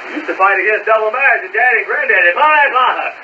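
normal talking voice

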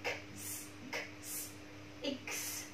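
"X" as soft voiceless "k" plus "s"; "ks" sound demonstration. Shown as a demonstration. Teacher isolating the sound of X, soft voiceless "ks". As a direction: release "X" as a quiet click and hiss. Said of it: "cái âm này được phiên âm là X".

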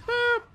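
very high, loud little boop; playful boop. Real, not acted. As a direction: squeak out a short, loud boop. Playful noise while shooting in game.

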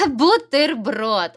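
drawn-out answer word Бутерброд, said slowly and falling. Shown as a demonstration. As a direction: stretch out Бутерброд as the correct answer. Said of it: "абсолютно вірно".

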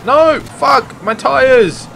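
high, loud cry as the tires go; loud dismay. Real, not acted. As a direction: shout in real dismay at the tires being shot. Tires shot out in race.

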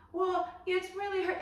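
high mock whine; whining.

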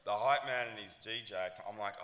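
ordinary talk

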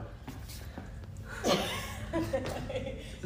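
soft wheezing breathless laugh. Real, not acted. Laughing during push-up contest.

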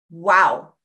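usual speaking voice

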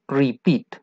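softly modeled "Repeat", accent on -peat; stress on second syllable. Shown as a demonstration. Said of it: "En la segunda". ("Repeat" suave, con acento en la segunda sílaba)

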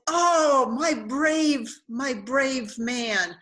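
loud, gushing praise from a tipsy host; slurred, delighted host addressing his brave guest; drunk delight. Retold or imitated. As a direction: hail him as a hero, half-drunk and overjoyed.

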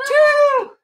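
loud, high shout of the count; calling out. Real, not acted. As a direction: call the number across the room. Calling out laps to kids.

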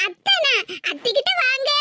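ordinary talk, nothing marked

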